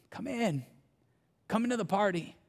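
begging. Retold or imitated. Father begging his older son to come inside, soft and gentle. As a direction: coax him in tenderly. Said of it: "He begs him".

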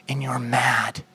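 soft, hushed whisper. Acted. God quietly naming Jonah's anger, whispered. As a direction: let the line fall to a whisper.

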